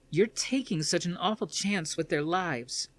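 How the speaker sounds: a daughter storming in to confront her father; upset daughter. Acted. Athena accuses her father.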